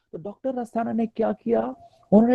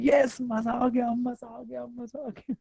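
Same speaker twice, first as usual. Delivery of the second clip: higher, slow forced cheer fading to a murmur; faked delight; 4 semitones higher, 5 dB quieter, slower than usual. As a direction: pretend to be thrilled. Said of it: "बाहर से क्या बोल रहा हूँ". (झूठी खुशी, धीरे धीरे मद्धम)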